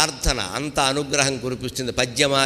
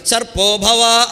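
thundering curse; 6 semitones higher, 6 dB louder, much slower than usual. Acted. Agastya's curse.

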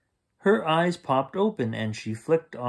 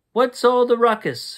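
louder, higher startled mother; high loud demand; 9 semitones higher, 6 dB louder than usual. A narrator's reading. Mother woken by a crash, loud and high. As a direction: demand to know the noise.

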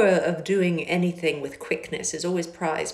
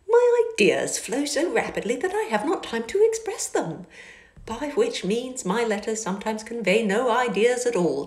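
put-on higher, louder, breezy young man; lightly voiced cheerful Bingley; 6 semitones higher, 3 dB louder than usual. A narrator's reading. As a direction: toss it off lightly.

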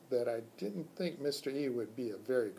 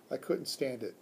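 ordinary delivery, nothing marked

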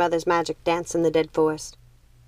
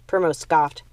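plain reading voice

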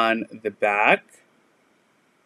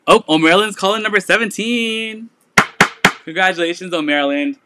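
higher, louder happy announcement; excited call-out; 7 semitones higher, 3 dB louder, faster than usual. Real, not acted. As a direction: cheer the buyer's name out. Announcing buyer on live sale.

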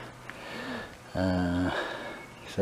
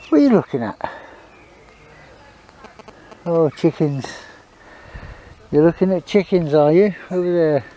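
pet talk; 6 semitones higher than usual. Real, not acted. Talking to dog.

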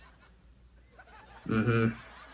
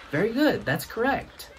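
higher-pitched approving grandpa; a lightly voiced pleased quizmaster; grandfather's praise; 9 semitones higher than usual. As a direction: praise the answer in a raised pitch. Grandfather praises the quiz answer.

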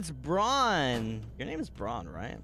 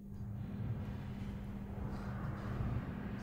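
long, soft, breathy exhale; long sigh; 13 dB quieter than usual. Real, not acted. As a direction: let out a long, weary sigh. Sighs before carrying on.

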